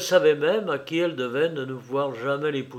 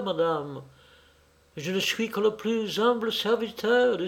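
higher, anguished lady; distraught society lady; 5 semitones higher than usual. Acted. Lady confessing to the clergyman, bitter and anguished. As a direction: voice her bitter self-reproach.

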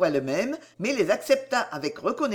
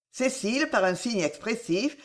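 ordinary delivery

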